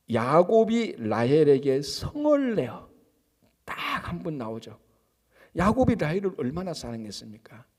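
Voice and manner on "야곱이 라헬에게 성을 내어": slow, weighty reading of 성을 내어; slow scripture reading. Shown as a demonstration. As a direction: read the verse slowly, like scripture. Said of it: "자, 삼십 장 이 절". (천천히 무게 있게 읽는 성경 낭독)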